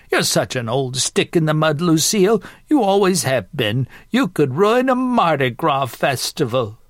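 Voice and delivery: teasing grandmother. Acted. Grandmother calling her sister a stick-in-the-mud, teasing. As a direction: rib her as a feisty granny.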